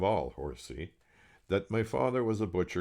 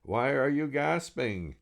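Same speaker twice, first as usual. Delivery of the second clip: higher, slow, louder nurse question; nurse shouting; 8 semitones higher, 5 dB louder than usual. Acted. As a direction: call it down to the patient. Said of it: "leaned over me and shouted".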